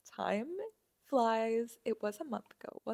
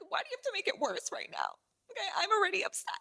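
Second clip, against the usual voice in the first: upset whine; 11 semitones higher, 8 dB quieter, much faster than usual. Retold or imitated. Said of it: "you're just like".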